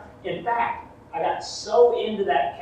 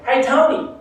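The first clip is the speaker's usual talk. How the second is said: teasing call; 7 semitones higher, 6 dB louder than usual. Retold or imitated. Brothers taunting.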